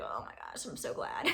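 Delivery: hushed, breathy secret relief; whisper. Retold or imitated. Retelling her breakup, whispering her secret relief. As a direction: whisper it like a guilty confession.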